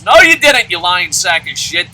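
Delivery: loud, furious comeback; angrily calling out a fake fan; angry retort. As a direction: snap back at the liar, loud.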